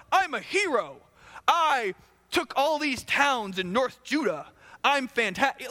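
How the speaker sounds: high, slow swaggering brag; boastful king. Retold or imitated. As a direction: puff yourself up like a vain conqueror. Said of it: "he's like".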